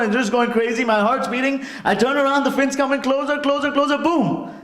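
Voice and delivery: high-pitched, breathless-paced build to a burst; panicked climax. Retold or imitated. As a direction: escalate the mock terror to an explosive last word. Panic peaks as fin closes in.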